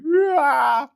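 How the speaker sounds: high playful growl; a silly little roar; mock roar. Finishing the mock rant with a playful roar.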